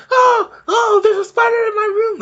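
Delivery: shrill high panicked shriek about a spider; retold panicked scream. Sister running out of her room, imitated screaming in panic. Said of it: "like, screaming and stuff".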